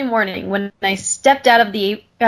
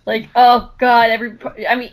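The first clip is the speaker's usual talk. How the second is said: normal talking voice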